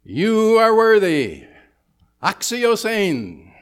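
high, slow proclaiming shout; acclaiming a new emperor. Retold or imitated. Crowd hailing the new Caesar as worthy, loud and slow. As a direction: hail him like a crowd greeting Caesar. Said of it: "And they would say".

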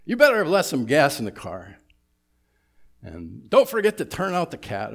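yelling wife. Retold or imitated. Wife yelling chores at her husband, loud and fast. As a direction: bark the chores at him fast. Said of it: "she yells at him and says".

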